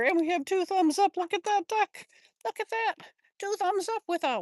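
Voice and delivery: high, loud excitement; real high-pitched delight; excited cheering. Real, not acted. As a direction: call it out to viewers, thrilled. Excited by thumbs up and duck.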